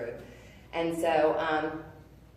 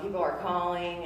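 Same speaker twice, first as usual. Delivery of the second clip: usual speaking voice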